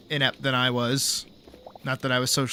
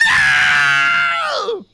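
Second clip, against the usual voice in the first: long, high, very loud howl of "no"; hammed-up scream dragged right out; screamed plea; 7 dB louder than usual. Rider leaning out of the cart going back in, acted long scream. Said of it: "top of my lungs".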